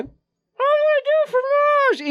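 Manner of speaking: showing his friend how the cartoon kid says it; Dexter cartoon voice. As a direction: squeak it out slowly like a cartoon boy genius. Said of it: "Just like that".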